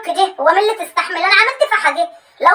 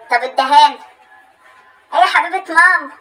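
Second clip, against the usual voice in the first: ordinary talk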